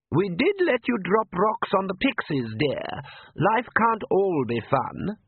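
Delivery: high, slow motherly coo; doting mother. A narrator's reading. Mother gently chides her daughter, slow and high. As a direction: gently chide like a loving mum. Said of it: "could only be a mother's voice".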